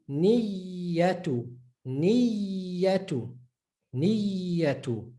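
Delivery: Niyatu with a long held iyy; stressed double y. Shown as a demonstration. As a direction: hold the y in Niyatu before -atu. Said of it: "just stress it a bit".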